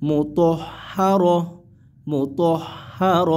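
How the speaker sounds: slow, drawn-out reading; slow tajweed reading; slow recitation. Shown as a demonstration. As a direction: chant each syllable slowly. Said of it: "এরকম উচ্চারণ হবে কিন্তু".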